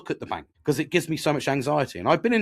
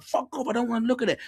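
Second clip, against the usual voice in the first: higher, mock angry snap; angry outburst; 9 semitones higher than usual. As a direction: throw a tantrum in the retelling. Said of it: "I get so angry".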